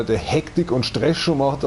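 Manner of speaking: usual speaking voice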